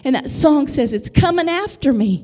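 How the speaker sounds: driving, emphatic sermon delivery; impassioned preaching. Real, not acted. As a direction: build the promise with fervour for the congregation. Preaches, voicing the Father's promise.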